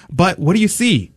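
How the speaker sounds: ordinary delivery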